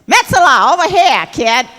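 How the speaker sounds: high, loud, brassy call across the room; Ethel calling out. Retold or imitated. Ethel waving her over at the party, loud high call. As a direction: holler her over like an old pal.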